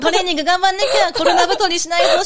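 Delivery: fast, high, loud and laughing self-pep talk; fired-up self-pep. Retold or imitated. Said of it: "しか思ってなかったです". (笑いながら早口で高く大きく自分を鼓舞する)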